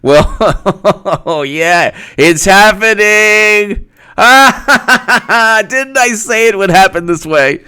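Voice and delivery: high, slow laughing glee; delighted slow cackling gloat. Real, not acted. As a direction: gloat through laughter, slowly.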